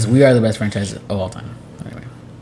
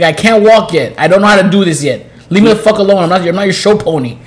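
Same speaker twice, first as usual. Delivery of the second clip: put-on loud, raised-pitch complaining baby; comic indignant protest; mock exasperation; 6 semitones higher, 5 dB louder than usual. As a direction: play a fed-up baby telling off a parent. Baby fed up with being taught.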